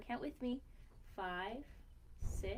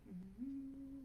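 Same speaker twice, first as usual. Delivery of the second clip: plain reading voice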